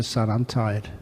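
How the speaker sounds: a dad half asleep telling his son he's tired; tired sigh. Acted. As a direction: let it drop, too tired to argue. Tired father brushes off son.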